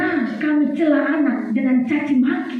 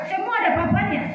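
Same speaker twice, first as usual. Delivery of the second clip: usual speaking voice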